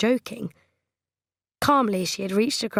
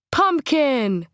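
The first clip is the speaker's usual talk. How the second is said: higher, louder plaintive wail of a pet name; pleading cry; 6 semitones higher, 5 dB louder than usual. A narrator's reading. Trent to Coral as she ends it, high plaintive cry. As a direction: wail it like a hurt child.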